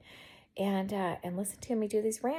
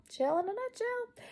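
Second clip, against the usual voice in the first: much higher, playful put-on repeat; 12 semitones higher than usual. Woman playfully repeats her own catchphrase in a high put-on voice. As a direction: squeak the catchphrase up high for fun.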